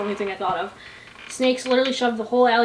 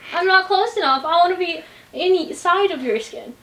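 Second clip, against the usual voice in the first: higher, slower cutesy voice; 6 semitones higher than usual. Shown as a demonstration. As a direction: say it sweetly and cute. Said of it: "I'll say it like that".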